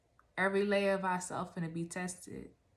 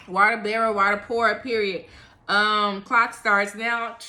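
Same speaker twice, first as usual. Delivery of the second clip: much louder, declaring each phrase; emphatic loud; 2 semitones higher, 12 dB louder than usual. Real, not acted. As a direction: proclaim it with force. Loud emphatic restatement of message.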